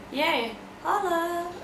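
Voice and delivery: playful slow celebration; slow cheer. Real, not acted. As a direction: stretch it out like a little cheer. Cheering over living in Hong Kong.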